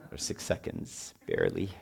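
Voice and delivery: breathy whisper of a joke; a voiceless joking aside. Real, not acted. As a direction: drop to a whisper for the punchline. Wry aside to congregation.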